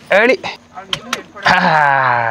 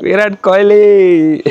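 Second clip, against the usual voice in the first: higher, louder, slowed and drawn out; playful excited echo; 6 semitones higher, 8 dB louder, much slower than usual. Real, not acted. Uncle echoes the child's favourite player, playfully excited. Echoing child's answer, teasing.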